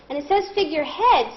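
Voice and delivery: ordinary delivery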